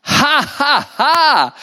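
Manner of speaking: high, staged "ha, ha, ha"; scornful, deliberately spaced theatrical laugh; Mammon's mocking laugh. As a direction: laugh it out as separate, derisive syllables. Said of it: "Weißt du, was der Mammon sagt?".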